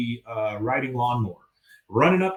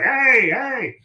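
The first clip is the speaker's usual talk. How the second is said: taunting call; 12 semitones higher, 4 dB louder than usual. Retold or imitated. Hank taunting the bull.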